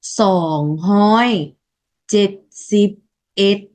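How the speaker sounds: "sòng hoi chết xịp ết" spelled out slowly, each syllable clear; slow Lao numeral demo. Shown as a demonstration. Teacher modeling "sòng hoi chết xịp ết" for students, slow and clear. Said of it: "mình đọc là".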